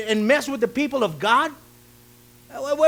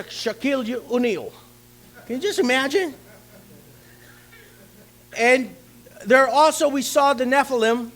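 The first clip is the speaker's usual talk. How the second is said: usual speaking voice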